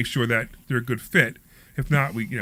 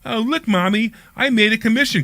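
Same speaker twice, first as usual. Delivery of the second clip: mocking childish boast; 7 semitones higher than usual. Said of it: "you can go home and go".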